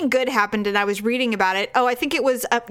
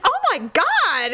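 mock exasperated husband; 8 semitones higher, slower than usual. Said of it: "He's like".

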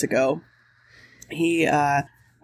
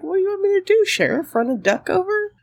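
high incredulous retort; indignant pleading question; exasperated; 13 semitones higher, faster than usual. Retold or imitated. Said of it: "But I'm like".

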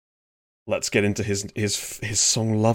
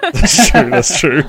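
laughing; 9 semitones higher, 9 dB louder, slower than usual. Real, not acted. Laughing at a joke.

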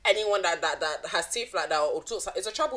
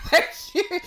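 laughing; 13 semitones higher, 6 dB louder than usual. Real, not acted. Laughing thanks.

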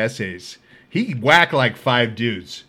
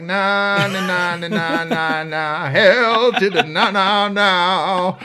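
higher, drawn-out dismissive chant of nah; mocking refusal; 5 semitones higher, much slower than usual. Guards refusing the inmate's cell request, mocking singsong no. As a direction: guards brushing him off, singsong no.

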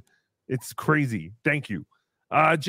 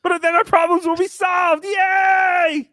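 much higher, louder repeat, ending in a yell; triumphant shout; 10 semitones higher, 6 dB louder, slower than usual. Real, not acted. Boss overrides his servant, shouting in triumph. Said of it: "But it's hungry, sir".

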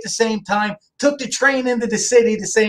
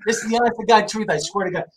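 ordinary talk, nothing marked

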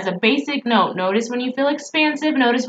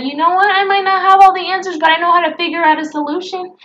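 excited; 8 semitones higher, 4 dB louder, faster than usual. Acted. Pep talk rising.

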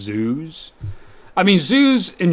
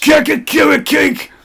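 tipsy yelling; 13 semitones higher, 3 dB louder, slower than usual. Real, not acted. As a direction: yell it out, tipsy. Tipsy guy yelling nonsense.

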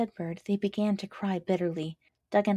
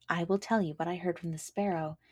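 ordinary delivery

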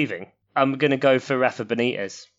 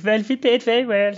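put-on high, squeaky German accent; squeaky German manager voice; Wagner impression; 9 semitones higher than usual. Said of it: "That's my attempt at Wagner".

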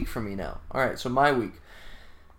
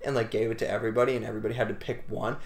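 ordinary delivery

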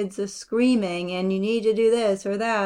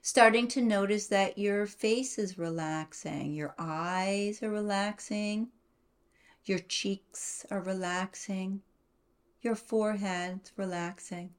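guided meditation; 6 dB quieter than usual. Acted.

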